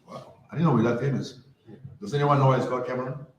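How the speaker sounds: ordinary talk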